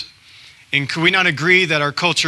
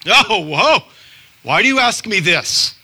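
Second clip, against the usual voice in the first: high, louder startled reply; shocked; 6 semitones higher, 4 dB louder than usual. Retold or imitated. Stranger asked about faith, a high, louder shocked reply. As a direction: jump back startled. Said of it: "the person looked at me in shock".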